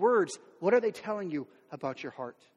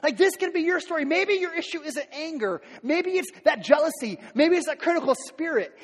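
ordinary talk, nothing marked

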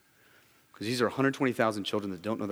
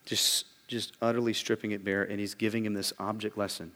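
normal talking voice

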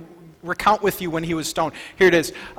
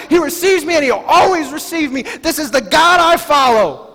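loud, higher, fervent preaching; full-force preaching; 6 semitones higher, 4 dB louder than usual. Acted. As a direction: preach it out boldly.

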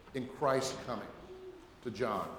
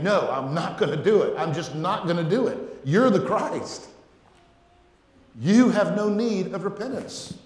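protesting refusal; 3 semitones higher, 5 dB louder than usual. Acted. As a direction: act out a firm, rising protest. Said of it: "He was hindering him".

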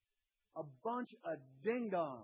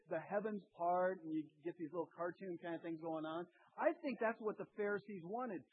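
ordinary talk

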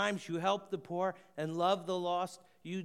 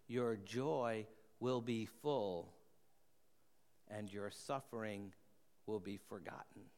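tender hush; 7 semitones lower, 7 dB quieter than usual. Real, not acted. Closing promise of heaven.

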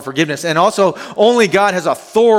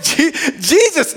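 usual speaking voice

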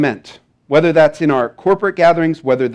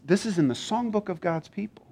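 ordinary delivery

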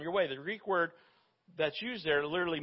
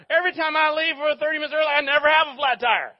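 mock exasperation; 7 semitones higher, 9 dB louder, faster than usual. Exasperated complaint about Murphy's law.

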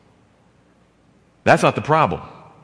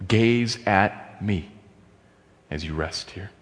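slow, much softer and lower invitation to rest; soft, slow, reverent invitation; gentle invitation; 5 semitones lower, 6 dB quieter than usual. Acted. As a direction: draw them in gently, slowly.

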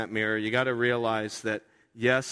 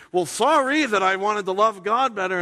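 mock defensive snap; 7 semitones higher, 6 dB louder than usual. Husband's defensive retort.

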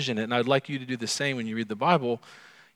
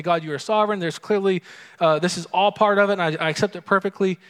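higher, louder, hurried fake piety; mock pious; 7 semitones higher, 6 dB louder, faster than usual. Someone brushing off grief with theology, in mock pious cheer. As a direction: rattle off the pat religious answer with mock cheer. Mocks pat pious answer.

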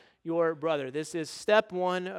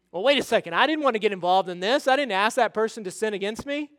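louder, hurried mock objection; indignant protest; 4 semitones higher, 6 dB louder, faster than usual. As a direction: object like someone dragged into it unfairly. Acted objector protesting the command.

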